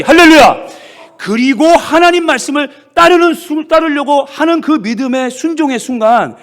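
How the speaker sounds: 높고 크게 외치는 설교; 절정에서 온 힘으로 외치기. Real, not acted. (회중 앞 절정의 외치는 설교)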